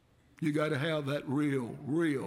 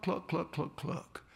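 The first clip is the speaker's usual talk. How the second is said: soft breathy hen clucks fading to nothing; mother hen clucking; 4 dB quieter, slower than usual. Retold or imitated. Preacher mimics the mother hen calling her chicks with clucks. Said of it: "she would stop and she would cluck".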